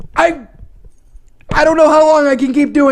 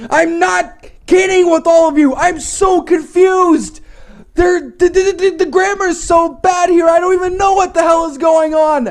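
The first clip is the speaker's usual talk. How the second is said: normal talking voice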